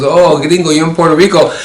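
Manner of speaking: high, loud, excited friend on the phone; retold delight. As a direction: imitate a friend thrilled by the news.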